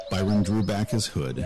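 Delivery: usual speaking voice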